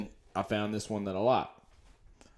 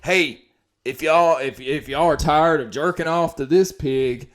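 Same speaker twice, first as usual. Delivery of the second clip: quoting a commenter; 4 semitones higher, 7 dB louder than usual. Retold or imitated. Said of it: "the next fucking one said".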